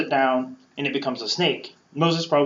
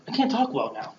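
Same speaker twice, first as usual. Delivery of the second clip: whiny excuse; 8 semitones higher, 4 dB quieter than usual. Retold or imitated. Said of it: "So he's kind of like".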